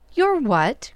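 sharp high astonished question; shocked cry of disbelief; startled cry. A narrator's reading. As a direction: jolt at the word. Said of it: "cried Joe".

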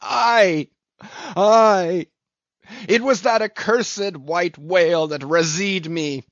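high, loud, impassioned lament; anguished shouting. A narrator's reading. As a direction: cry it out in wounded rage. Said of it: "he shouted with a terrific, loud, animal sob".

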